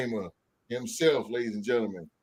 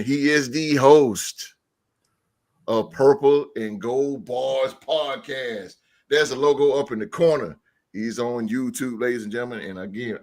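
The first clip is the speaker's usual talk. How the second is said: ordinary talk, nothing marked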